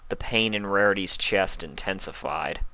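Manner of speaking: usual speaking voice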